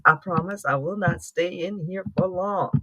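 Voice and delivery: normal talking voice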